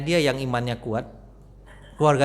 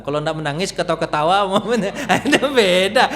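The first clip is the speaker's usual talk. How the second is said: ordinary delivery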